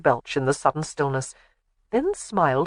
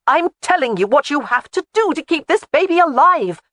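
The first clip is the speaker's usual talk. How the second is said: high, loud desperate shout; 10 semitones higher, 5 dB louder than usual. A narrator's reading. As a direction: shout to make him listen. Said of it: "she shouted".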